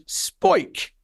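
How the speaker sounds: put-on high, stretched Jersey-accent call of the name. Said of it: "like, Jersey accent".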